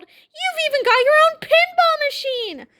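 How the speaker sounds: high-pitched amazed excitement; cried out. A narrator's reading. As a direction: cry it out, thrilled by the discovery. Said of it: "cried Harold".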